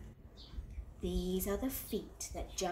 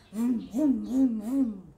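loud engine vroom; 9 dB louder, slower than usual. Acted. Spinning wheels.

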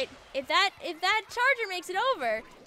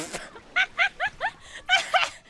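squealing high-pitched laugh; 14 semitones higher, 3 dB louder than usual. Real, not acted. Laughs after failed climb.

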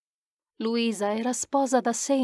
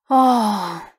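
exclamation with a big breath; 7 dB louder than usual. Acted. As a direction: let it out with a large breath, loudly. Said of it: "Esclamò con un largo respiro".

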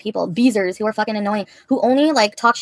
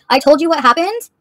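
angry; 5 semitones higher, 7 dB louder than usual. Real, not acted. Repeated insistence.

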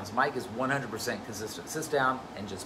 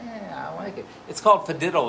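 ordinary talk, nothing marked

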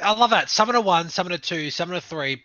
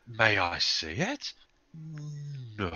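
Steamed Hams skit impression; 7 dB quieter, slower than usual. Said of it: "steamed hams on with A S M R".

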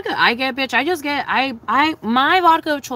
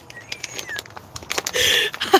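very high breathless laugh; airy high giggle; squeaky laugh; 4 dB quieter than usual. Real, not acted. Laughing about chapped lips.

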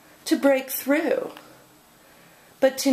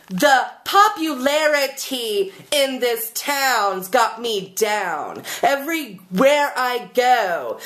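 angry screaming poem; 2 semitones higher than usual. Pretend. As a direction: belt it out like an angry slam poet. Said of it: "I can write angry, screaming poetry".

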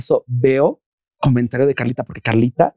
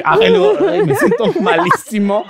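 high-pitched, drawn-out whine with laughter; mock misery. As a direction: wail slowly about feeling awful, hammed-up. Recrea su angustia entre risas. (lamento agudo y exagerado, entre risas)

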